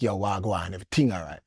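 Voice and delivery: a casual island hello in patois; Jamaican patois. Retold or imitated. Manager greeting him in patois, hushed Jamaican. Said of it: "it's patois".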